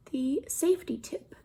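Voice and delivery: plain reading voice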